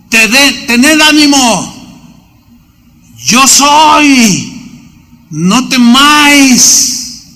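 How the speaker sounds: slow, high, calming voice of Jesus; steady them with slow comfort; reassuring Jesus. Acted. Jesus calming the frightened disciples, reassuring. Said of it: "Jesús les habló diciendo".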